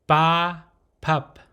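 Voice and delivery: "ba" open with an unaspirated p, "pup" closed on the lips; unpuffed p starting "ba" and "pup"; soft P demonstration. Shown as a demonstration. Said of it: "This consonant is pronounced".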